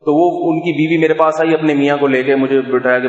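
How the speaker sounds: normal talking voice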